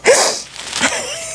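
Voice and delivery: squeaky unvoiced laugh; a delighted high giggle; high breathy giggle. Real, not acted. Owner showing her cat to viewers, high giggle.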